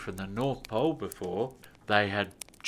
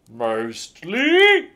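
loud, higher doubtful Santa; questioning Santa; 7 semitones higher, 11 dB louder than usual. A narrator's reading. Santa doubting the elf, loud and suspicious. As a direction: raise a suspicious eyebrow in the voice.

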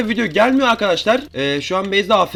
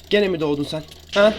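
ordinary talk

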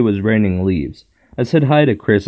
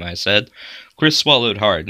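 usual speaking voice

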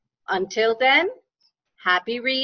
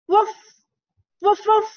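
dog woof; 9 semitones higher, 4 dB louder, faster than usual. Acted. Dog sound in story.

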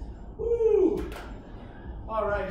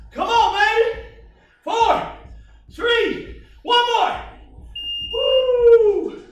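hyped countdown shout; 7 semitones higher, 7 dB louder, much slower than usual. Real, not acted. Coach counting down final reps.

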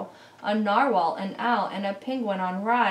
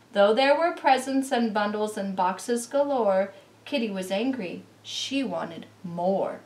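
plain reading voice

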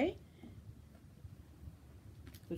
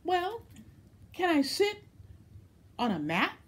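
frog voice; 13 semitones higher, 4 dB louder, faster than usual. Acted.

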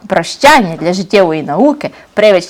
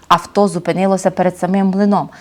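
ordinary delivery, nothing marked

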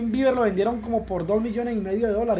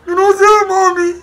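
higher, louder mock sob; fake crying; 9 semitones higher, 10 dB louder than usual. Son fakes crying to his worried mother, mock sobbing. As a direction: wail it like a hurt kid putting on tears. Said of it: "No llore, no llore".

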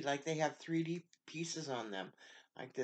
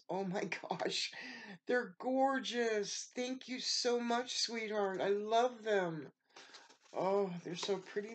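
high, squealing thrilled praise; overjoyed gasping thanks; gushing delight; 7 semitones higher than usual. Real, not acted. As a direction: swoon over the gift, pitch way up. Gushing over gifts from Maria.

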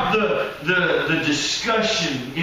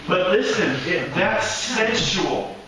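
ordinary delivery

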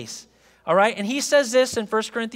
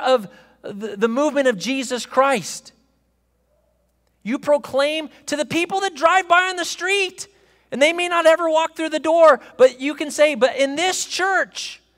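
preaching at full force; 4 semitones higher than usual. Acted. Pastor urges congregation to proclaim their faith, full preaching. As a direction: preach it out to the whole room.

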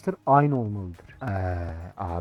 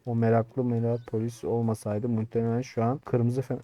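usual speaking voice

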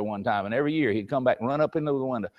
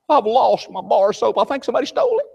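put-on whiny kid; 7 semitones higher, 3 dB louder than usual. Child at camp complains to dad, high and whiny. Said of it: "they'd say".

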